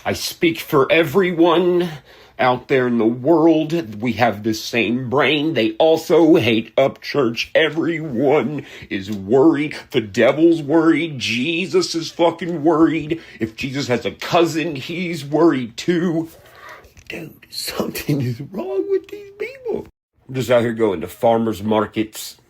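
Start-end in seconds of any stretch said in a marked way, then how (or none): 0.0-16.3 slow, higher-pitched mock spokesperson; hammed-up, slow sing-song parody; mocking hater voice. Mocking a hater's self-introduction. 17.1-19.9 very high, softer put-on voice; thin, high-pitched parody of worry; mocking critic voice.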